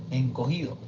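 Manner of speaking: usual speaking voice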